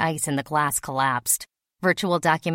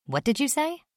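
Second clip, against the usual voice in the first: pitched up high in shock; a startled boy's disbelief; startled question; 9 semitones higher than usual. A narrator's reading. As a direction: caught off guard, asking back. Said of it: "Basil jumped, quite taken aback".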